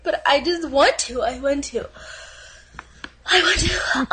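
crying. Acted. Dating video girl gushes about cats, laughing and crying. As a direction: sob it out while laughing at yourself. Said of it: "And she's starting to cry".

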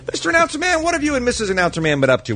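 playful high call. Acted. Playful address to announcer.

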